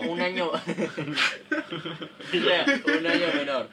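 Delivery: laughing. Real, not acted. Laughing while correcting.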